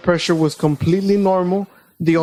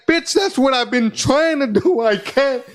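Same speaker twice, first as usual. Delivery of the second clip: higher, mock-outraged snap back at the nurse; exasperated retort; 7 semitones higher than usual. Fed up with the nurse telling him to breathe, an exasperated higher retort. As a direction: play it up like you can't believe her.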